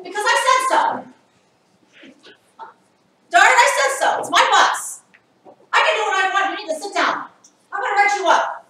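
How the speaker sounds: high, very loud, furious demo shouting; angry yelling driver. Shown as a demonstration. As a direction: yell it like a bus driver who has lost it. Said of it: "this kind of stuff doesn't work".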